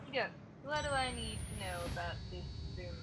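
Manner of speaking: ordinary talk, nothing marked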